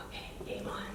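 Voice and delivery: very soft, hushed answer; whispered reply. Retold or imitated. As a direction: whisper back conspiratorially. Whispers back to the girl.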